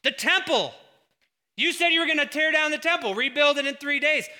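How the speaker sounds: loud, high jeering shout; the crowd taunting Jesus, loud and scornful; mocking taunt. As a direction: jeer at him like the crowd. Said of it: "What, what was the taunt?".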